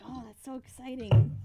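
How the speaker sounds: high, loud canned computer voice; old computer voice. Retold or imitated. Mimicking an old school computer cheering, loud mock robotic. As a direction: play the old speech program talking. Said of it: "computers were just like".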